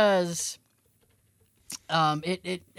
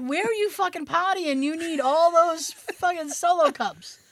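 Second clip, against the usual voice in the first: incredulous; 7 semitones higher than usual. Retold or imitated. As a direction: scold in disbelief. Retold incredulous question.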